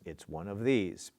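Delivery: ordinary delivery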